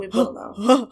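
laughing, playfully ominous promise; mock ominous. Host teasing her cohost that the mystery will be revealed, laughing mock ominous. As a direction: tease it like a spooky trailer.

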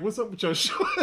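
tickled, barely getting it out; laughing retold tease. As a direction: imitate her mocking question through laughter. Retold teasing about shorts, laughing.

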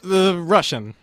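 loud accent cue; announcing cue loudly; called-out cue. Real, not acted. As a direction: shout the next accent. Host calling out accent.

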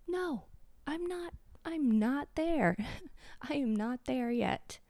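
high, breaking tearful voice; crying. Real, not acted. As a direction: push the words out through tears. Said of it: "I'm sorry that I'm cry".

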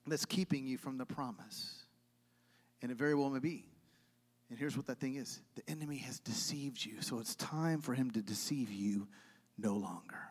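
low, very soft sermon delivery; hushed preaching. Real, not acted. Preacher reveals the enemy's deceit, hushed. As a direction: sink toward a whisper for weight.

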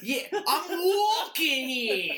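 hammed-up New York shout through laughter; New York accent. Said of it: "comes out all New Yorkie".

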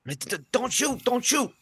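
stammering, high-pitched begging not to be shot; mock terrified soldier, voice way up; hammed-up panicked plea. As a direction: beg for your life, overdone. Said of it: "moving in a wounded fashion".

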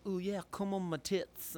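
high, slow mock English woman; badly faked English accent, stretched out. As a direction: imitate an Englishwoman, hammed up and high. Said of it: "that's a perfect English accent for her".

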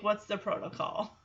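usual speaking voice

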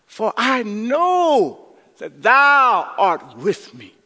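slow, loud, stretched-out psalm line; drawn-out climax. Acted. Pastor quoting the psalm at the sermon's peak, slow and loud. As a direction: stretch every word to the peak.

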